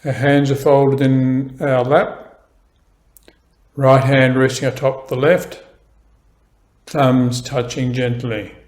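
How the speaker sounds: slow, calm meditation guidance; guided meditation. Acted. Teacher guiding beginners through posture, slow calm meditation voice. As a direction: ease the listener into stillness, slowly.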